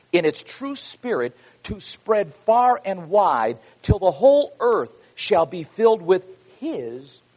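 put-on slow, loud pulpit reading of an old text; fervent preacher's delivery; preaching a quote. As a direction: proclaim it to the congregation like scripture. Preaches Samuel Adams quote.

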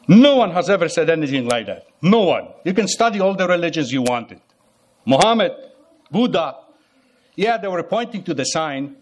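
ordinary talk, nothing marked

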